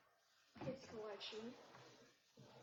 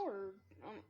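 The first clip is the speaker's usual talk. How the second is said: ordinary delivery, nothing marked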